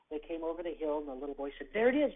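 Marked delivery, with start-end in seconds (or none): 1.7-2.1 put-on excited child. Boy recognises his street.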